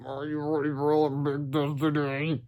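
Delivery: slow, soft droopy voice; droopily. A narrator's reading. George to Harold before the test, slow droopy drone. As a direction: drag it out, half asleep. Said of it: "asked George droopily".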